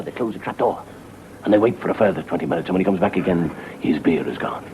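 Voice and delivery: ordinary talk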